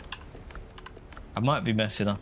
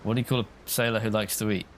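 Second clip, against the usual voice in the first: usual speaking voice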